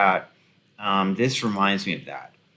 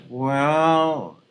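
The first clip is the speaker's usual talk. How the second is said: higher, slow, drawn-out reluctant correction; mock hesitant tech expert; 4 semitones higher, slower than usual. Commentator imitating an industry guy correcting an elitist, drawn-out and hesitant. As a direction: let the elitist down gently, stretching the words. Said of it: "that guy was like".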